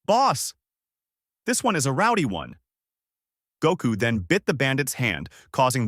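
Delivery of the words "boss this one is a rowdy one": yelled. A narrator's reading. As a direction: holler across to the boss. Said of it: "The bandit yelled out".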